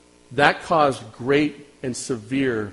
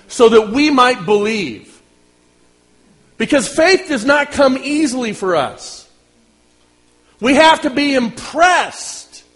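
high, loud, emphatic sermon delivery; impassioned preacher, pitched up and stretched out; forceful preaching; 9 semitones higher, 7 dB louder, slower than usual. Real, not acted. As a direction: drive each phrase home slowly to the congregation.

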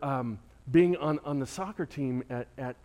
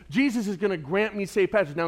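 ordinary delivery

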